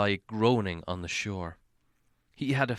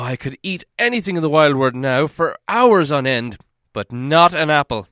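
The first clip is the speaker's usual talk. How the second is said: louder, raised complaint; complaining; 6 semitones higher, 9 dB louder than usual. Acted. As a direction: grumble loudly like a sore young dwarf. Fili complaining about apples.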